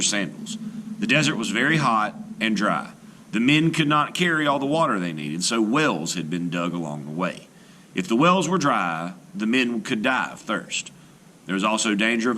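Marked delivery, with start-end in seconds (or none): none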